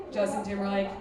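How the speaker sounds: ordinary delivery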